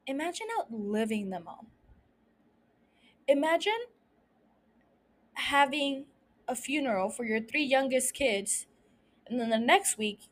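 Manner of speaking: soft, measured, with long pauses; hushed and solemn. Real, not acted. Host asks listeners to picture burying their children, hushed and solemn. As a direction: let each grim thought sink in quietly.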